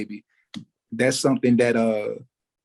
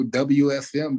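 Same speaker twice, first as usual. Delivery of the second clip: ordinary talk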